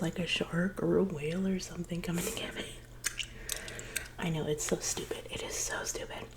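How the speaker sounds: normal talking voice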